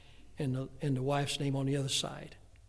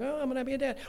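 weary second-time dad; 9 semitones higher, much faster than usual. Retold or imitated. Preacher imitating himself hearing of the second baby, deflated. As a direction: shrug it off, been here before. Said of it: "the second time it's like".

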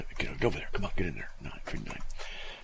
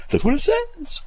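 squeaky high, loud mimicry; mock it in an exaggerated high-pitched voice; mocking friend; 5 dB louder than usual. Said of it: "a friend of mine mocks me".

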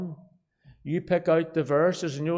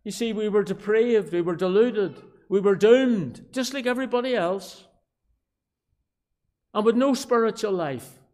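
high, loud pulpit declamation; emphatic preacher's cadence; fervent preaching; 6 semitones higher, 7 dB louder than usual. Real, not acted. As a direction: hammer out each charge to the congregation. Preaches at full force.

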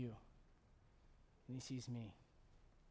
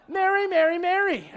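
high, mocking Marcia-style whine; lightly voiced jealous sibling; jealous sister whine; 13 semitones higher, 15 dB louder than usual. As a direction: sneer at the favourite sister. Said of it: "Marcia, Marcia, Marcia".

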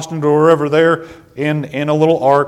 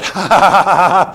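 a hammed-up ha-ha, higher and louder; mock gloating laugh; 5 semitones higher, 5 dB louder than usual. Preacher plays Moses gloating over Miriam, mock laughing. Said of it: "And Moses said".

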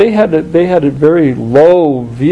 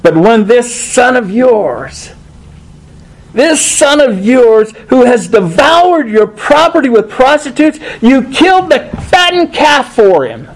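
put-on bitter older son; 6 semitones higher than usual. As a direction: drag it out with contempt for this son of yours. Older son accuses his brother.

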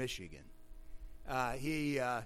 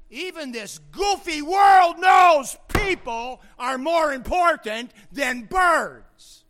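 slow, high, very loud pulpit cry; full-force preaching shout; 11 semitones higher, 17 dB louder, slower than usual. Real, not acted. Preacher insisting people outrank birds, full-force preaching shout. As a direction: shout each word out to the whole congregation. Sermon climax, shouted to congregation.

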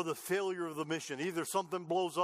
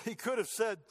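ordinary talk

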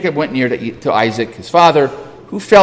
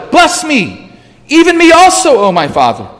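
high-pitched anguished plea, falling at the end; great bitter cry; 11 semitones higher, 4 dB louder than usual. A narrator's reading. Cheated son begging his father for a blessing, crying out bitterly. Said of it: "cried out with an exceedingly great and bitter cry".

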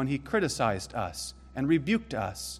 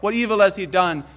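loud, higher pleading question; a lightly voiced Pilate, louder and higher; Pilate's question; 6 semitones higher, 8 dB louder than usual. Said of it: "And Pilate said".

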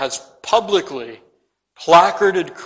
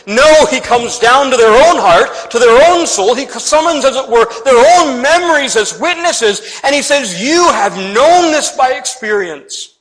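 higher, louder, impassioned pulpit delivery; fervent, emphatic sermon climax; forceful preaching; 6 semitones higher, 6 dB louder than usual. Acted. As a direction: punch each phrase out to the congregation.

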